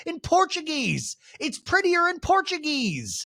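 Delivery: very high, drawn-out protest; Monica impression. Castmate excusing her swearing, high mock-defensive voice. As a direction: stretch it out in a high silly voice. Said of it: "And Monica's like".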